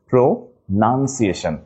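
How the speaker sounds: very slow "pronunciation" as pro, nun, ciation; syllable by syllable. Shown as a demonstration. Teacher correcting class, "pronunciation" slowly split around nun. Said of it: "not noun".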